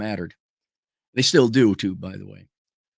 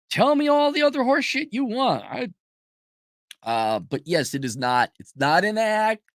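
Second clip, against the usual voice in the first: normal talking voice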